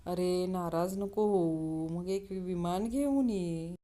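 put-on slow, drawn-out drunk voice; drunkard. Drunkard placates the offended pilot, slow drawn-out drunk voice. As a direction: placate him with long tipsy vowels. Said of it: "दारुड्या".

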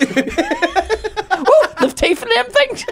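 high, slow, laughing squeal; mock squeamish voice. Joking about fingering women, a high squeamish squeal.

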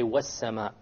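"وَالسَّمَاءِ" with silent lam and doubled s; no l sound in "وَالسَّمَاءِ"; lam dropped. Shown as a demonstration. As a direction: go straight from "wa" into a held s in "وَالسَّمَاءِ". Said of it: "we drop the lam and we say".

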